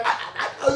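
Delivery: breathless unvoiced stammering laughs; stuttering. Tongue-tied mid-scene, stammering in breathless laughs. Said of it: "I'm stuttering".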